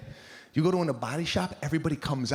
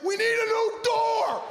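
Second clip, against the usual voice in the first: much higher, loud outburst; exasperated shout; 3 dB louder than usual. Retold or imitated. Husband seeing wrecked car door, shouting. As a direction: blurt it out in disbelief.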